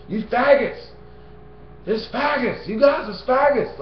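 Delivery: mock bullhorn preacher.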